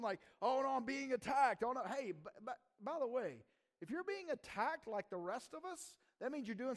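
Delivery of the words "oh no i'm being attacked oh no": loud, high mock alarm; acted fear. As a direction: play someone panicking under attack. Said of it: "Their fear, like, a genuine".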